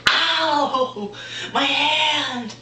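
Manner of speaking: high, loud hammed-up howl; mock pained wail. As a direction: wail over a hurt hand, mocking him. Acted pained cry after hitting.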